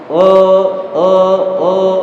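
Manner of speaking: a drawn-out thick Oh; thick isti'la Oh; thick voice demo. Shown as a demonstration. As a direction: raise the back of the tongue and sound Oh. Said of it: "langsung pasti secara otomatis".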